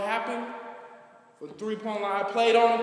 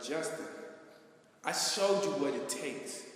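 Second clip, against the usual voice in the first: hushed voice of God; 5 semitones lower, 8 dB quieter than usual. Retold or imitated. God's voice, hushed.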